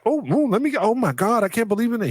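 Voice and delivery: high, squealing mock fan girls; excited girls. Teller mimicking excited girls crowding Kobe, squealing high.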